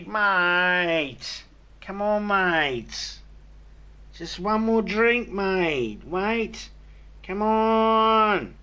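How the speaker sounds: put-on loud, high, drawn-out drunken pleading; drunk Aussie. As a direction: wheedle loudly like a boozed-up Australian mate. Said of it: "when we get drunk, we use the word mate".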